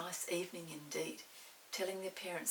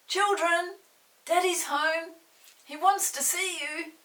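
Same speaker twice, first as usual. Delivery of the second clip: much louder, higher call across the garden; Mother calling across the garden, loud; 10 semitones higher, 12 dB louder than usual. Acted. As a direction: call the children in.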